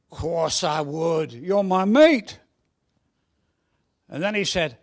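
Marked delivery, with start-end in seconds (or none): 0.1-2.4 put-on slow Cockney boy, building to loud; Cockney accent. Second boy swearing loyalty to his mate, slow swelling Cockney. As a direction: draw out a hearty Cockney pledge. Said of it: "Should I put on a Cockney accent".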